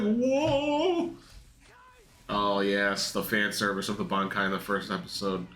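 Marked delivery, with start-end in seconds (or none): 0.0-1.1 squeaky high startled whoa while laughing; a cartoon gasp of shock, pitched way up; mock Hollow shock. Laughing viewer mimics the startled Hollow's face, squeaky high. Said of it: "He's like".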